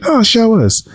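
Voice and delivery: high, mocking squeal of people with hair; mock hair-flaunting person. Mocking people who ask to see his hair, in a high squeal. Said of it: "these folks with hair be like".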